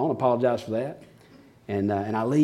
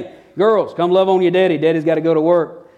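higher, louder call across the house; cheerful calling out; 5 semitones higher, 6 dB louder than usual. Retold or imitated. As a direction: round up the girls for hugs. Dad calling daughters each morning.

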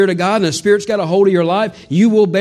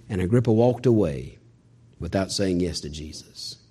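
much lower and softer, fading to a hush; solemn fade; 10 semitones lower, 8 dB quieter than usual. Acted. Preacher telling Agrippa walked away, fading to a hush. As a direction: let it die away sadly.

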